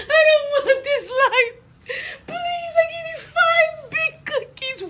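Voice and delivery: high, slow mock-crying child; crying kid voice. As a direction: beg through fake sobs. Child begging for cookies.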